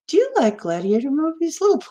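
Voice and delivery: put-on high, swooping creepy old man; old guy impression. Old guy leaning over to a little boy, imitated in a high, swooping voice. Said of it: "the old guy leans over and goes".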